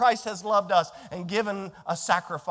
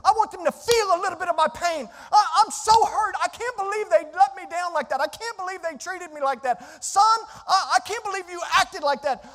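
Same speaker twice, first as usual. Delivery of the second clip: angry flesh voice; 8 semitones higher than usual. Retold or imitated. Preacher voicing the congregation's hurt grumbling. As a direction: act out the wounded complaining self. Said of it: "what we do is we're like".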